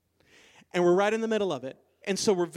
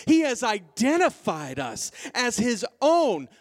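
raised, emphatic, stretched key words; impassioned preaching; 4 semitones higher, 3 dB louder than usual. Real, not acted. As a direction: proclaim it to the whole congregation with ringing conviction. Preaching at full force.

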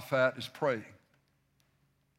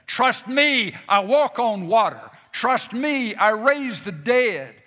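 higher, louder proclamation in God's voice; preaching at full force; 5 semitones higher, 5 dB louder than usual. Acted. Preacher speaking as God to the church, booming and raised. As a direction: thunder the promises out to the congregation.

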